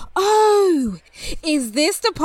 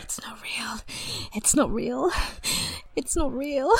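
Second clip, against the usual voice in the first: shocked murmur; 11 dB quieter than usual. Acted. Shocked after seeing dead body.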